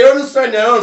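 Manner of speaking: high, loud protest of innocence; indignant shout. Retold or imitated. Shouting back at the police officer, indignant and loud. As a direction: shout back that he's no foreigner. Offended at being called foreigner.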